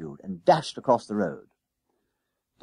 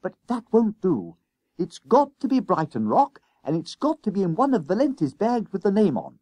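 put-on high, eager boy pleading earnestly; a keen young lad insisting it must be so; high eager schoolboy voice; 9 semitones higher, 4 dB louder than usual. A narrator's reading. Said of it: "replied Jennings earnestly".

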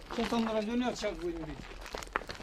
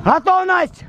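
shouted command; 5 semitones higher, 5 dB louder, slower than usual. Real, not acted. Readying the gun to fire.

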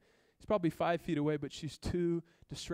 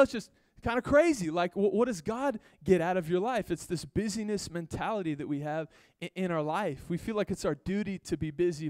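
usual speaking voice

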